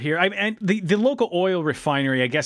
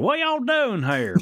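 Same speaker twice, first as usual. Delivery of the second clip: high, drawn-out yokel drawl; yokel accent; 9 semitones higher, slower than usual. Retold or imitated. Imitating the character Spike, high slow yokel accent. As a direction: put on a hammed-up yokel accent. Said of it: "like, a yokel accent".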